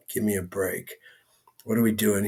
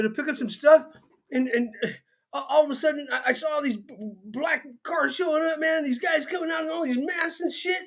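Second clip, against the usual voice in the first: high-pitched jittery guy voice, much higher throughout; comic character voice; 13 semitones higher than usual. Acted. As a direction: play a nervous, excitable man rushing in. [name] arrives in character voice.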